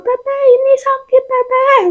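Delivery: high, strained cry to her sister; calling out in pain. Retold or imitated. Calling her sister from the bathroom in pain, high strained cry. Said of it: "Aku teriak tuh sama".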